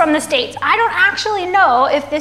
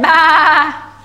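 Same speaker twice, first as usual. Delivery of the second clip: drawn-out imitated bleat; sheep's baa; 7 dB louder than usual. A narrator's reading. Sheep in a children's story, long baa. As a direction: bleat like a sheep in a picture book. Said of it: "Sheep said".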